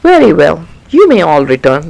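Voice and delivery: deciding king. Acted. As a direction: give the royal verdict. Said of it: "the king decided".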